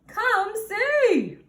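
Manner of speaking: high, loud come-see; excited call. Acted. Husband calls wife to see the piglet, high and loud. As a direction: call her over, thrilled. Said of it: "he called".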